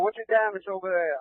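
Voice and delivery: normal talking voice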